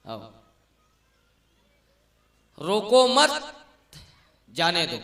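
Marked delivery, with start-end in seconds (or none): 2.6-5.0 "रोको मत" rising and loud, pause, then "जाने दो"; group रोको with मत, break, then drop on जाने दो; loud lift on मत, long pause after it. Shown as a demonstration. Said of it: "सुनो तो शब्द ल".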